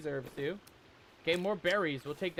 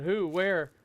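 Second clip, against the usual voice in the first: ordinary talk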